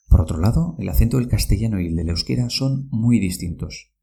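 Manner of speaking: usual speaking voice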